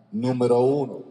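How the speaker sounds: ordinary delivery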